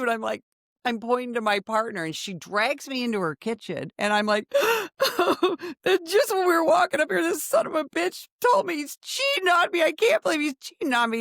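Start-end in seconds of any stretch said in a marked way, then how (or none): 4.5-11.2 high, gasping mock sob; acted sobbing. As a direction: play up a tearful betrayal, high-pitched. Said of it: "I'm sobbing".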